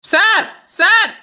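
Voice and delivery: high, slow, drawn-out call; stretched-out heckling call. Acted. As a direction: pester him with long, teasing shouts. Heckler calls out to passenger.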